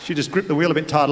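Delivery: ordinary talk, nothing marked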